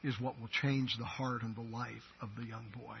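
usual speaking voice

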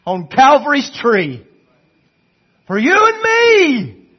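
loud, high, impassioned pulpit cry; fervent preacher at full volume, drawing out key words; full-force preaching. Acted. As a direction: shout the testimony out to the whole church.

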